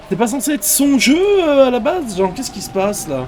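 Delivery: very high, loud squealing panic; shrieking panic. Pretend. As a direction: squeal it out in mock terror. Streamer squeals in mock terror.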